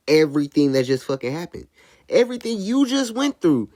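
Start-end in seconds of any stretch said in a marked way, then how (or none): 2.1-3.7 high, hammed-up announcer voice; mock a cheesy show commentator; mock corny commentator. Mocking DJ Akademiks narrating the tragedy, high and corny. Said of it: "he's doing this corny ass voice over it".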